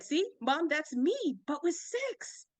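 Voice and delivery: put-on child's eager insistence, voice climbing high; eager kid pleading. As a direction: press mom to finally get it. Kid pushing banana analogy on mom.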